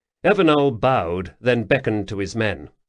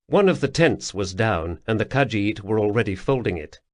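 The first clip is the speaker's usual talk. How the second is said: usual speaking voice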